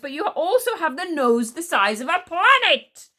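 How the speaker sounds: high, loud hurling of insults; angry shout. A narrator's reading. Boy wizard insulting the witch-hating queen, shouting furiously. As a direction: shout it out, building to the last insult. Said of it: "shouted Tsar".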